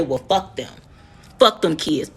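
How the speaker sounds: a hint of a low, callous father; imitated dismissive dad. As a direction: drop the pitch to mimic a man brushing it off. Voices the children's dad.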